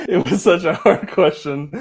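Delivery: words broken by real laughter; laughing. Real, not acted. Host joking that the guest froze, laughing. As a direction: giggle through the line.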